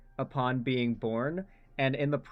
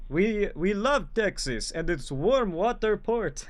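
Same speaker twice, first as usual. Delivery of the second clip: bad Russian accent; 6 semitones higher, slower than usual. Streamer mocking a supposed Russian asset, in a hammed-up Russian accent. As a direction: greet her as a comrade in a badly faked Russian accent. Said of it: "I can't do a Russian accent".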